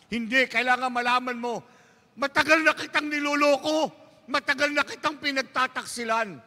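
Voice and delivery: very high, strained confession; desperate dying husband. Acted. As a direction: force out the secret before dying. (nagmamakaawang asawang naghihingalo, napakataas)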